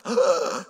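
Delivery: loud mock gasp of horrified kids; big acted gasp; shocked gasp. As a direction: imitate kids gasping in shock. Imitating shocked kids in the audience.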